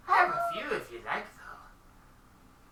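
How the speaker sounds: high, slow, prim merchant; high shopkeeper voice. Acted. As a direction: answer sweetly in a high falsetto.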